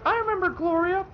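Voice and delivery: high mock grandma voice with a laugh; imitating grandma. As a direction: squeak it out like a giddy grandma. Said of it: "and she's like".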